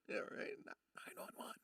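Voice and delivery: a slow breathy whisper; slow hushed whisper, half laughing. Acted. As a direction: whisper it like a joke aside. Jokey aside after laughing.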